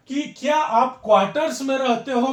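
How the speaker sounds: normal talking voice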